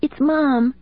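put-on high girl's voice full of worry; a scared child breaking bad news; worried young daughter. A narrator's reading. Daughter telling her dad about mom, high and worried. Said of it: "the worry and fear in his daughter's voice".

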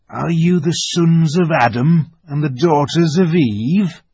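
high, loud creature voice; a timid animal's questioning voice; lisping buck-toothed beaver. Acted.

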